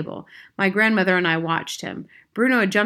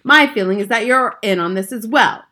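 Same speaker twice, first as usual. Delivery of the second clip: raised, louder accusing voice; angry accusation; 3 semitones higher, 8 dB louder than usual. A narrator's reading. Father suspects grandmother is in on it, angry.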